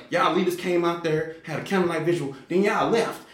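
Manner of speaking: an angry woman calling out the leaders for leaving; mad lady. Retold or imitated. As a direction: scold the leaders like a furious churchgoer. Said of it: "she was mad".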